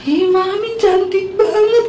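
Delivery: put-on high, drawn-out girlish gush; delighted children. Daughters admire mum's new long hair, delighted high gush.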